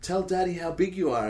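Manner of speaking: high, sing-song mum voice; coaxing mother. Acted. Imitating the wife prompting their child in a message, high-pitched coaxing. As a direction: coax a small child to speak up. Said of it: "the wife's like".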